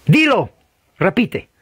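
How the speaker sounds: high, insistent order; commanding preacher. Acted. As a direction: imitate a revivalist pushing the convert to repeat. Acting preacher commanding the convert.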